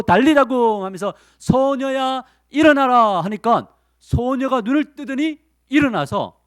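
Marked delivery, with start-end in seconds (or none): 0.0-0.8 "달리다 굼" called out high and louder; commanding call. Acted. Jesus calling the dead girl to rise, commanding. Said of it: "예수님이 그 죽은 아이의 손을 붙잡고". 1.4-3.2 high, loud summons; commanding call. Acted. Jesus calling the dead girl to rise, commanding. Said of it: "손을 붙잡고".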